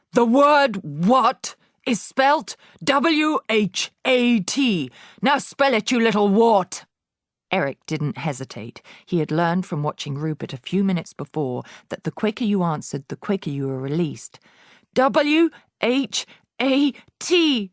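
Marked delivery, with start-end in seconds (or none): 0.1-6.9 much higher, louder, slow spelt shout. A narrator's reading. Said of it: "The Trunchbull was shouting". 15.0-17.7 much higher, slow squealed letters. A narrator's reading. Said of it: "he squealed".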